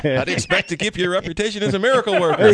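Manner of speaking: hammed-up Scottish engineer line; bad Scottish accent. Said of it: "no guarantees on the accent there".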